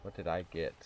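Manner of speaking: usual speaking voice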